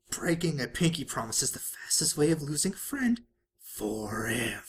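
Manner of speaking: unvoiced creepy whisper; ominous whisper. A narrator's reading. Pinkie pops up warning Big Mac, eerie whisper. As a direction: breathe it as a spooky warning.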